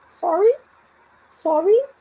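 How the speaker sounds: "Sorry" with a high rising end; each "sorry" lifted into a question. Shown as a demonstration. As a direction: ask "Sorry" as if you didn't catch it. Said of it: "num tom de pergunta".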